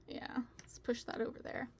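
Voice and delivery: whispered to herself; whispering. Real, not acted. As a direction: mutter it under the breath. Mumbling while arranging.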